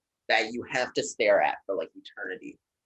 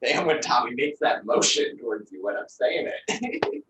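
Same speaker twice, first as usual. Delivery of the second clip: normal talking voice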